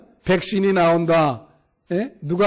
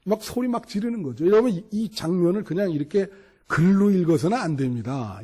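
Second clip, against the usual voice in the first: ordinary talk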